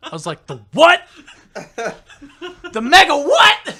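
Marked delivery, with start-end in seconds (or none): 0.5-1.0 very loud, high shriek of disbelief; incredulous shout. Retold or imitated. Storyteller reacting to the mega fart, incredulous shout. 2.7-3.7 slow, high, full-volume disbelief; incredulous yell. Retold or imitated. Storyteller questioning coworker's mega fart, incredulous yell.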